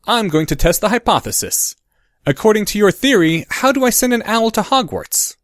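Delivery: lightly voiced eager boy; young boy asking keenly. A narrator's reading. Child Harry asking parents.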